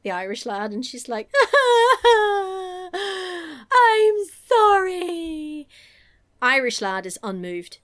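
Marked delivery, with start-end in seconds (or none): 1.4-2.9 loud, high hammed-up bawling; exaggerated fake crying; mock sobbing wail. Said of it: "she just starts sobbing". 2.9-5.7 high, loud wailed apology; hammed-up sobbing. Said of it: "she just starts sobbing".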